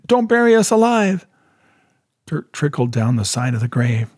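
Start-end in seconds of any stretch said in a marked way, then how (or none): 0.1-1.3 high, terrified plea; pleading. A narrator's reading. Ray begging the man with the light, high terrified plea. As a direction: beg in a high frightened voice. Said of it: "Ray pleaded".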